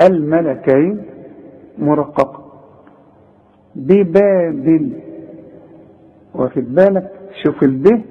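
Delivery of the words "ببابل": ببابل slow, with each ب thin; light ba. Shown as a demonstration. Teacher recites ببابل with thin ب sounds. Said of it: "الب هنا مرققة ازاي".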